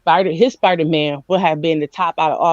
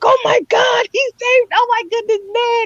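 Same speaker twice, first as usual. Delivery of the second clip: very high, breathless shout of joy; overjoyed squeal. Retold or imitated. Retelling the cape saving Ned, squealing very high. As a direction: gush like a fan whose hero was saved. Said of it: "I was like".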